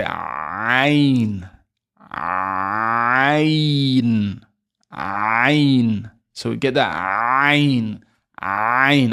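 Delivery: clamp the throat hard and drag out ayn; over-done Arabic ayn; exaggerated ayn. Shown as a demonstration. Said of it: "I'm exaggerating".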